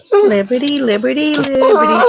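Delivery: sing-song call to a pet; calling the dog. Real, not acted.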